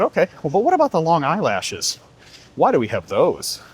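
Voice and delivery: a small camel asking its mother why; curious baby camel. Acted. Baby camel asks mother about humps, curious child voice.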